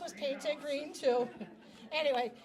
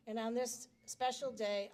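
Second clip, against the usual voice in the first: ordinary talk, nothing marked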